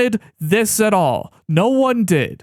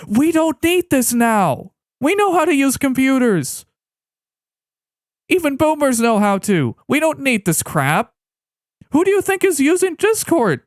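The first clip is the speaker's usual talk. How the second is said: exasperated; 8 semitones higher than usual. Real, not acted. Exasperated rant at developers.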